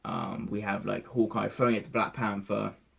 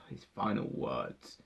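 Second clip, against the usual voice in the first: softer lower sighing repeat; sighed, softer; 5 semitones lower, 7 dB quieter than usual. Real, not acted.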